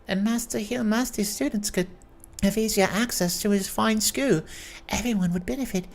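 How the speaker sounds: put-on high-pitched cheery elderly lady voice; high jovial old lady. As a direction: play the chatty old aunt, bright and high.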